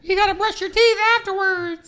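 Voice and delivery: very high cartoon tooth voice; squeaky mascot voice.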